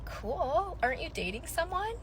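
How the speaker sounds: high, soft sarcastic reply; deadpan sarcastic. Retold or imitated. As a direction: say it with fake breeziness. Her retold reply to ex.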